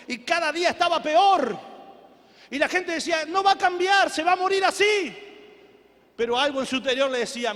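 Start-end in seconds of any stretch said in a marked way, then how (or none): 3.3-5.2 lightly voiced doubters, pitched high and louder; emphatic, raised-pitch verdict; naysaying crowd. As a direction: quote the people insisting it's hopeless. Gente desahucia al borracho.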